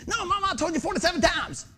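high-pitched mock outburst at Mama; mock exasperated yell. Son pretending to yell at his forgetful mother, exasperated. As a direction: act out losing patience, voice jumping way up. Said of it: "Why am I yelling up and down".

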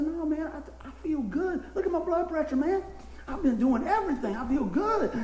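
high, bright insistence he feels fine; cheerful insisting. Retold or imitated. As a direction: insist you're healthy. Retold insisting to doctor.

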